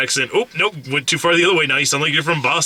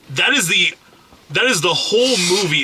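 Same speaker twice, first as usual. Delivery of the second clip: normal talking voice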